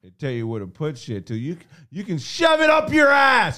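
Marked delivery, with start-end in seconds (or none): 1.4-3.6 high, loud mock shouting; hammed-up furious boss.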